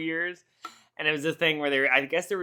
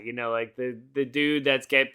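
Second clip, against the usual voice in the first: ordinary talk, nothing marked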